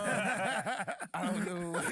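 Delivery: bursts out laughing mid-sentence; real laughter. Real, not acted. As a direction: laugh it out, high and helpless. Laughing at his own story.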